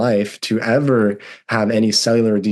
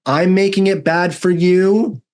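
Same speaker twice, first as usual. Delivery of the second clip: much higher, slow, rising in disbelief; incredulous retort; 10 semitones higher than usual. Retold or imitated. Son snapping back at his mom, incredulous and high. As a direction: fire it back in disbelief, pitch climbing. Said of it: "my voice in that tone".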